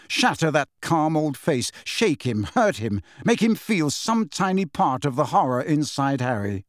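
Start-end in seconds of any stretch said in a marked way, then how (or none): none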